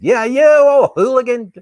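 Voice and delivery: mock scold. Scolding an old hooligan.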